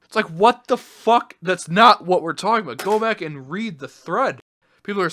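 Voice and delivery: real loud exasperation; outraged. Real, not acted. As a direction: blow up at the reply. Outraged reaction to Luna's reply.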